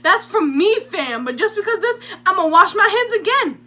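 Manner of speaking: high, loud exasperated insisting; defensive Bob. Retold or imitated. Bob defends his handwashing to the counselor, loud. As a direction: protest loudly, fam-style. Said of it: "And he goes".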